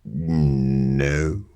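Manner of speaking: ordinary delivery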